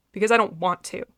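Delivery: spat out with venom; venomous. A narrator's reading. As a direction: say it like spitting poison. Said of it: "he spat venomously".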